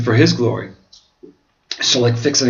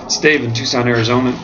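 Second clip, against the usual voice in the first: ordinary delivery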